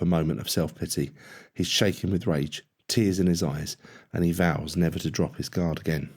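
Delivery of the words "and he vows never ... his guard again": ordinary delivery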